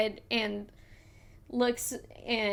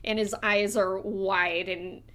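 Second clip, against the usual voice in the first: ordinary delivery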